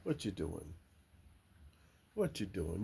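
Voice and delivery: hushed, low coaxing; quietly asking the hens what they're doing; soft talk to hens. Real, not acted. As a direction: murmur gently to the chickens. Talking softly to hens.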